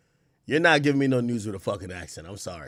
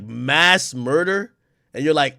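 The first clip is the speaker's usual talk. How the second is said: usual speaking voice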